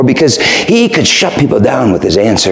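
ordinary delivery

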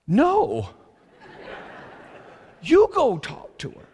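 loud, high-pitched acted refusal; mock emphatic refusal. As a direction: push it back at her, hammed-up and loud. Acted refusal to wife's demand.

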